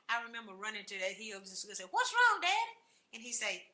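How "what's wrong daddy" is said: high, loud anxious call. Retold or imitated. Child running to her father, calling high and loud. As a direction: shout the question as you run uphill.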